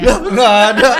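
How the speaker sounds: loud, high-pitched laughing protest; laughing denial. Real, not acted. Guest accused of crying the most, loud laughing denial. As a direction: wave off the teasing loudly, laughing.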